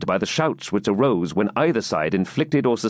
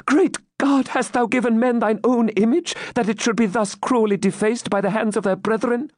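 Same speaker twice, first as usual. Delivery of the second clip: high-pitched, anguished outcry; horrified appeal; 13 semitones higher than usual. Acted. Maiden appeals to God over the slaughter, horrified. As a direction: cry to heaven in acted horror.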